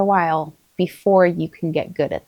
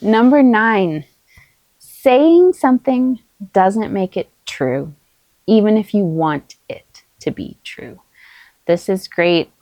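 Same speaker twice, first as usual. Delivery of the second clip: ordinary talk, nothing marked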